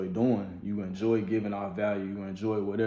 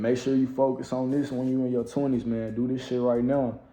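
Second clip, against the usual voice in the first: ordinary talk, nothing marked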